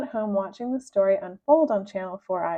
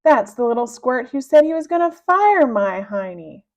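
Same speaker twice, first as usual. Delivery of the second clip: angry; 3 semitones higher, 8 dB louder than usual. Acted. Angry accusation.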